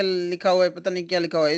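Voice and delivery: ordinary delivery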